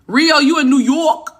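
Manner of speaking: loud, playful fake New York accent; hammed-up New York accent. Shown as a demonstration. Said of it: "that's my fake New York accent".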